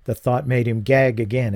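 normal talking voice

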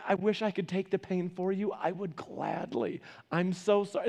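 soft, sorrowful parent's comfort; tender. Retold or imitated. Parent to child with burnt hands, tender.

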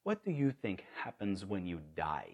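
hushed, low Kenyan-accented imitation. Kenyan evangelist questioning a stranger, imitated Kenyan accent. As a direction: ask it softly and gravely in a Kenyan accent. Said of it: "in that great Kenyan accent".

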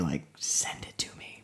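soft conspiratorial whisper. Real, not acted. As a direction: whisper it like a secret request. Asks listeners for recipe.

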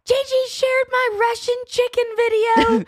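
high, loud, imitated outrage; high-pitched mock indignation; mortified daughter. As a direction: mimic a mortified daughter wailing a complaint. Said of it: "Tatum came home just mortified".

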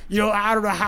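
usual speaking voice

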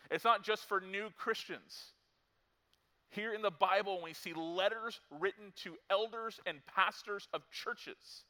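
high, loud, insistent pulpit voice; full-force preaching. Acted. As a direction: press the contrast home from the pulpit.

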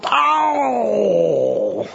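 high, pained mock groan; groaning crowd. Preacher mimics losing fans, high disappointed groan. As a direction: groan like fans who just lost. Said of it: "forty thousand people are going".

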